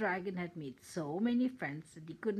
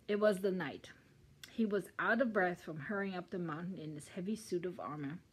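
ordinary delivery

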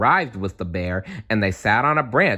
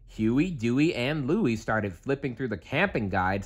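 ordinary delivery, nothing marked

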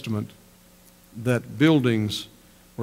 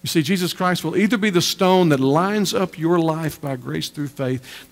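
usual speaking voice

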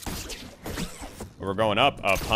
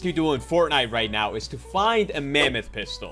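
video intro; 6 semitones higher than usual. Acted. Upbeat YouTube video intro.

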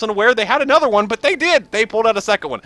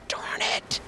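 breathy frustrated whisper; whispered curse; 10 semitones higher, 15 dB quieter than usual. Real, not acted. Lost in the game, frustrated whisper. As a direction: hiss it under your breath.

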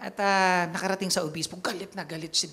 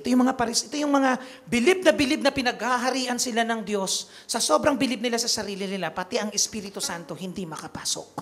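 louder, raised preaching voice; impassioned preaching; 3 dB louder than usual. Acted. Priest to congregation, preaching with full force. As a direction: drive the point home to the flock.

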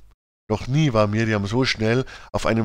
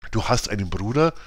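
plain reading voice

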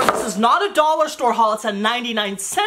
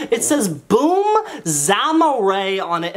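ordinary talk, nothing marked

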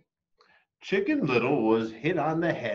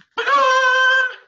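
giggle; 12 dB louder than usual. Acted. Chicken giggles interrupting.

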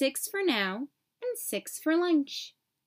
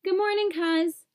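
mouth full; 9 semitones higher, 3 dB louder than usual. A narrator's reading. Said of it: "with his mouth wide open".

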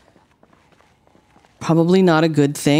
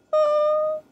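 startled yelp; 4 dB quieter than usual. Real, not acted. Sudden start.

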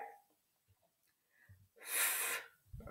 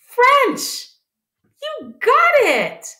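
French and You got it much higher and louder; excited cheer; 5 dB louder, slower than usual. Acted. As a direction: cheer them on in a high, loud voice. Teacher cheering the kids on.

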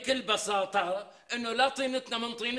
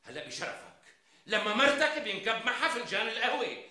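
usual speaking voice

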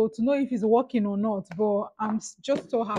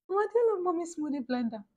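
ordinary talk, nothing marked

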